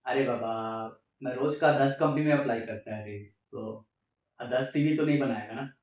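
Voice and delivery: put-on fast Marathi-accented Hindi reply. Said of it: "typical Marathi accent".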